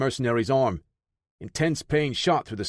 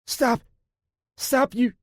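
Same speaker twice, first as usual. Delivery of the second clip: much higher, strained cry; desperate shout; 13 semitones higher, 3 dB louder, slower than usual. A narrator's reading. Parker crying out at the interrogators, much higher strained shout. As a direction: yell to make them stop.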